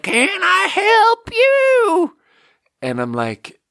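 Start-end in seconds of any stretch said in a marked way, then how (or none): 0.0-2.2 put-on very high, strained, drawn-out old-man yelp; hammed-up craggly geezer, stretched slow and high. Craggly old man offering help at the dump, hammed-up screech. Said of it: "this craggly old man".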